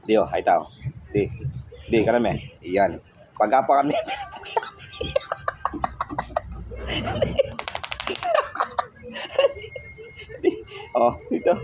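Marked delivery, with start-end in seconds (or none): 4.0-8.8 long squealing laugh, breathless; long high giggle. Real, not acted. Vlogger laughing helplessly at the pet, long high giggle.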